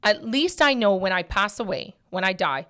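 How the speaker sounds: normal talking voice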